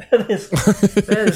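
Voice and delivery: half-laughing through held-back tears; a laugh that's mostly emotion breaking through; choked-up laugh. Real, not acted. To his co-host, overcome by the Cinderella Man scene, teary laugh. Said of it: "Let it out".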